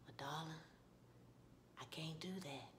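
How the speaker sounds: low, near-whispered gentle refusal; Ralph Angel. Retold or imitated. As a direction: let her down very softly, haltingly.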